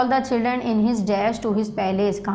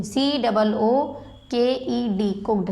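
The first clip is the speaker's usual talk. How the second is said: slow spelling out; much slower than usual. Shown as a demonstration. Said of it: "मैं spelling भी इसमें dictate करती जा रही हूँ".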